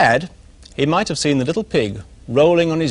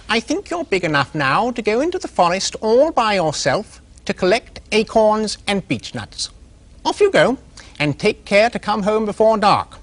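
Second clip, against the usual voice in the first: put-on high, gentle mother voice sending her child off; a mum pig fussing over her little one; high, caring mother pig; mother pig's voice; 7 semitones higher than usual. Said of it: "his mother said to him".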